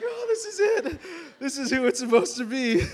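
high, breathless gushing through a laugh; swooning delight. Pretend. Girl meeting her destined husband, giddy high squeal. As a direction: squeal it like a dream came true.

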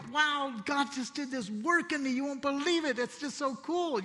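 excited. Retold or imitated. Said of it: "I'm just like".

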